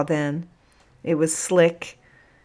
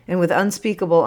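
ordinary delivery

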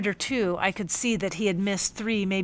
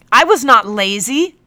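louder, higher indignant retort; 5 semitones higher, 12 dB louder than usual. Acted. Captain denying laziness, indignant loud retort. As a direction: fire it back, stung. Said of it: "he retorted".

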